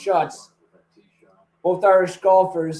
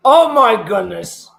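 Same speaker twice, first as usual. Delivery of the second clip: surprised; 4 semitones higher, 8 dB louder, slower than usual. Real, not acted. Reacting to missed putt live.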